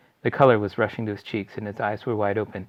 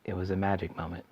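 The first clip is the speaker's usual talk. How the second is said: usual speaking voice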